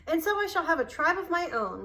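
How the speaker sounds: put-on bursting with a boy's excitement; excited. A narrator's reading. Boy telling panther of his plans, excited and eager. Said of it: "Mowgli said excitedly".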